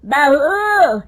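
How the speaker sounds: loud, high, drawn-out call. Acted. Mother-in-law calling daughter-in-law to bring tea, calling out. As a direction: holler for her, stretching the words.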